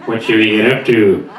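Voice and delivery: low cartoon dad leer; Homer Simpson. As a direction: drop low after the whoop, in cartoon character. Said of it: "Homer comes back to the house".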